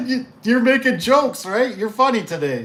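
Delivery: high, forced laugh into the words; joking disbelief. Acted. Installer laughing off the customer's complaint, mock amusement. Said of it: "And you're like".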